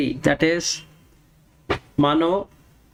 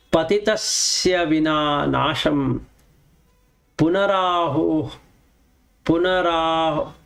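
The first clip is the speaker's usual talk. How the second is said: verse recitation. Acted. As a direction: intone the verse word by word. Recites next Sanskrit verse.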